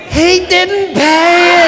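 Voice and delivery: loud, slow, drawn-out punch line; emphatic punchline. Acted. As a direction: hammer each word slowly for the laugh. Punchline repeated for laughs.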